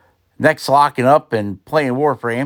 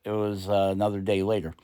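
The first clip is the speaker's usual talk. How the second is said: ordinary talk, nothing marked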